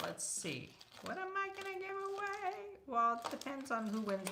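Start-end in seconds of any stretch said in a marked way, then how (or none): 1.0-2.5 high, soft silly voice; playful squeaky voice. Pretend. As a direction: toss the question up in a tiny voice. Repeats musing in put-on voice.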